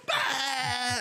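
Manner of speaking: sudden high yowl like an angry cat; high animal screech; cat hiss imitation. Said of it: "and he goes".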